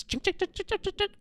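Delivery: high, soft mock chisel clinks; chiseling noises. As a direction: tap out chisel sounds on stone. Chiseling stone tablet noise.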